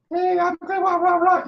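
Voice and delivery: very high squeaky doll voice. Shown as a demonstration. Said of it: "play with your little dolls".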